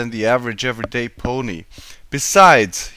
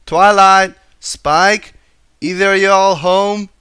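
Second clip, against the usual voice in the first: loud, higher call at the door; louder, higher country call for Twilight and Spike; 7 semitones higher, 9 dB louder, slower than usual. A narrator's reading. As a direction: call into the house. Calling at the library door.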